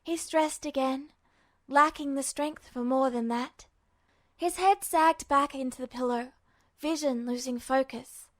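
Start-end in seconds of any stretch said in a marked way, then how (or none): none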